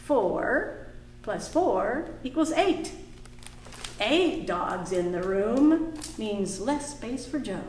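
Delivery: ordinary delivery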